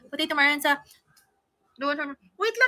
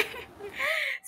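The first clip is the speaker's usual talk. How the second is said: high giggle; 11 semitones higher, 8 dB quieter than usual. Real, not acted. Bursts out laughing.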